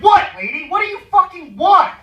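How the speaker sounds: loud, high-pitched irritated yell; exasperated shout, replayed for laughs; retold angry outburst. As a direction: act out snapping at a pest. Retold snap at pestering passenger.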